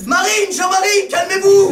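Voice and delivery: high, loud, slow shout; shouting to calm them. Acted. Charlie yelling at the angry pigs to calm down, a high, loud, slow shout. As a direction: yell at them to settle down. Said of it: "Alors Charlie disait comme ça".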